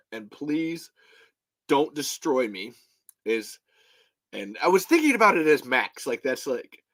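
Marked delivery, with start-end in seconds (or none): none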